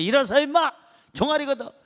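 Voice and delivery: high, loud mock command; angry father. Father ordering his son to stand, imitated angry shout. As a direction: order the boy up like an irate father. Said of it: "화가 나가지고".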